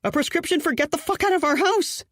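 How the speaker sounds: high, exasperated yell; frantic shout. Acted. Fiancée yells at Kip about bird, high exasperated. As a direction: keep yelling, fed up and scared.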